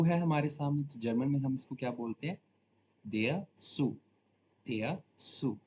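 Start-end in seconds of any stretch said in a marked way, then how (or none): 3.0-5.6 Zoo said with an S sound, like sue; German pronunciation. Shown as a demonstration. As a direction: say Zoo as German tsoo with a hissy start, not zoo. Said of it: "इसका sound आएगा सू".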